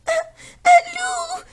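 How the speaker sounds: very high, frightened stammer; stammering fright. Acted. Pierre stammers at the shadow, very high and scared. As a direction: stumble on the word in panic. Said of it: "bégaye Pierre".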